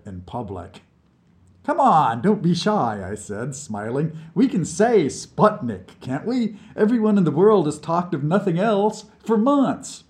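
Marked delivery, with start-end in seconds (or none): 1.6-10.0 high, loud coaxing cheer. Acted. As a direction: egg him on with a grin. Narrator coaxing Vlad.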